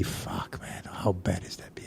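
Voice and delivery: hushed, voiceless disgust at the beer; disgusted whisper. Retold or imitated. Newcomer whispering his disgust at the awful pub beer. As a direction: whisper it like you can't believe the taste.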